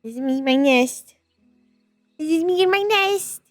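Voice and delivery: ordinary talk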